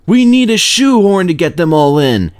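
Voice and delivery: a loud exasperated guard; exclaiming guard. A narrator's reading. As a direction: call it out in dismay. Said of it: "exclaimed the guard".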